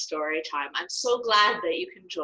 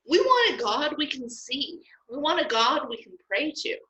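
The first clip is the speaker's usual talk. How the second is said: demanding crowd; 7 semitones higher than usual. Acted. People demand a visible god.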